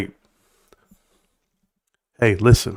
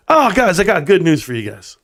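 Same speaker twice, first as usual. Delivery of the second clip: usual speaking voice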